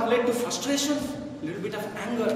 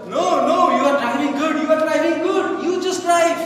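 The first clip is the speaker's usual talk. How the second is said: high, raised-voice imitated plea; urgent pleading; 7 semitones higher, 5 dB louder than usual. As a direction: plead urgently as George, voice pitched up. George grabs shoulder, refuses, reassures driver.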